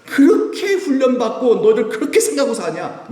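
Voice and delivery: high, loud incredulous rebuke with a laugh; exasperated rebuke. Retold or imitated. Jesus rebuking his disciples, high and exasperated. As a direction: scold them in disbelief.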